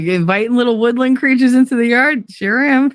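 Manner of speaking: high, lilting mock-innocent reply; playful comeback. Telling Fredo she invites woodland creatures, playful high comeback. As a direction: pitch it up, cheerfully unrepentant.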